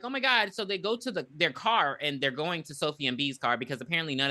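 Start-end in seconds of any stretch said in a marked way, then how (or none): 0.0-0.5 a higher, alarmed oh my God; mock panic. Friends retold reacting to the injury, mildly alarmed. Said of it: "they're like".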